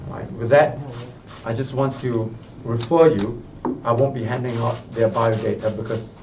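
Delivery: usual speaking voice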